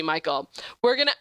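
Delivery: ordinary talk, nothing marked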